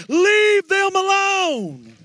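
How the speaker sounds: very high, slow sustained shout; held preaching cry. Acted. Pastor cries out to the congregation to let the young worshippers be. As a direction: hold it out over the whole room. Sermon peak.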